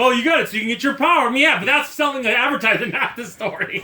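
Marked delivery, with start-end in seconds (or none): 0.0-1.3 mocking ad voice. Mocking the ad pitch.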